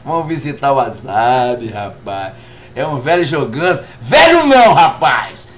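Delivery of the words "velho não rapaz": loud retort. Real, not acted. Grandpa protests being called old, loud retort.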